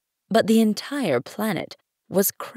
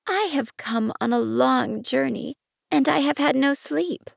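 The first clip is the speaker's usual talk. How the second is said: put-on higher-pitched little boy voice; apologetic little prince; 7 semitones higher than usual. A narrator's reading. Tired prince explaining himself to the king, meek and higher. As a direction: explain yourself meekly, pitched up.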